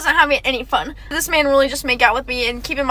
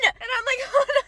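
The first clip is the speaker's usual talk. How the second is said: laughing; 8 semitones higher, 7 dB quieter than usual. Real, not acted. Laughing at his kiss attempt.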